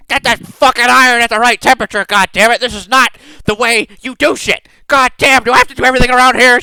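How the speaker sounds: a tiny boss bellowing curses across the forge; obscene furious tirade. Acted. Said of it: "shouting obscenities for the most part".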